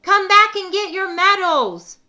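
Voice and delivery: high and loud, calling out; calling after them. Acted. Pete calling after the fleeing turkeys to come back. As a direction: call after the runaways. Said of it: "Pete called out".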